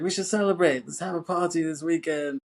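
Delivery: high, singing-out excitement; imitating ecstatic mom. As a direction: gush it as a delighted mom proposing a party. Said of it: "really ecstatic".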